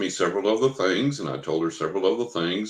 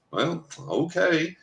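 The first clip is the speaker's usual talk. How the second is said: slow, higher-pitched hesitant okay; retold reluctant acceptance; 8 semitones higher, slower than usual. Client answering bad marriage news, hesitantly accepting. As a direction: imitate her drawn-out, unconvinced agreement.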